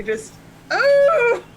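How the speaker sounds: a short high yelp of a howl; a loud, high, clumsy little howl; howling like a wolf pup. Acted. Tries the howl in the bar.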